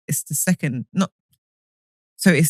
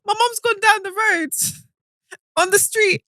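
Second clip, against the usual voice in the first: high-pitched talk through laughter; telling the story while laughing hard, voice high. Real, not acted. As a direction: keep laughing as you tell it.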